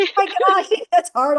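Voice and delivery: laughing mock struggle.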